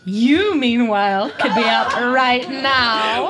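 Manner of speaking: slow, drawn-out come-on with a laugh; hammed-up flirty invitation; mock seductive. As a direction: Claire teasing Jamie into bed, slow and suggestive. Claire propositions Jamie, voiced.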